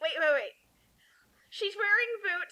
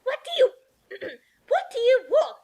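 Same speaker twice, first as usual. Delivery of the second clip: higher, louder sharp demand at the door; Aunt Petunia at the door; 6 semitones higher, 5 dB louder, faster than usual. Acted. Aunt answering the door to Hagrid, higher and louder. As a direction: snap it out as the suspicious aunt.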